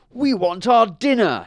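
a high, loud cow's complaint; demanding cow. Acted. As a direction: demand dinner as a hungry cow. Cows demand their food.

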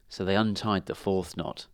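usual speaking voice

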